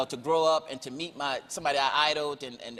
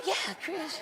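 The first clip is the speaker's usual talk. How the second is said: soft, higher Michael impression; gentle high answer; Michael answering from his disguise; 10 semitones higher, 7 dB quieter than usual. Voices Michael.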